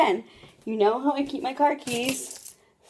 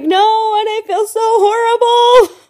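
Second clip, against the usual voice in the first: high, loud retold wail; distressed wail; 11 semitones higher, 5 dB louder, slower than usual. Retelling her reply to James on the phone, high guilty wail.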